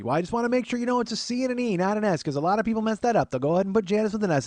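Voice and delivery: high, hurried imitated aunt; fussy aunt. Aunt explaining Janice's spelling to nephew, fussy aunt voice. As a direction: prattle on about the spelling.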